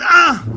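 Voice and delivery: high piercing scream of pain; sudden high shriek; child's scream. Retold or imitated. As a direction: scream like a hurt little boy. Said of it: "So he screamed".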